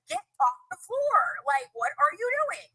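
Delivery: high, stretched-out scolding; imitated exasperated order. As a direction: scold like a fed-up cop, drawing it out. Voicing police ordering her up.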